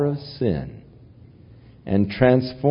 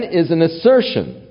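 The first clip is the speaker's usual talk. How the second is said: normal talking voice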